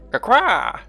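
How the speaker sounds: high, loud mock quacking; duck quacks. Voicing the bird's reply.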